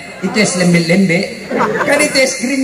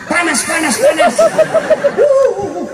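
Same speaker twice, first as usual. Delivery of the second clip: frantic shout; 7 semitones higher than usual. Retold or imitated. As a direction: yell the warning in panic, voice cracking high. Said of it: "Dia teriak".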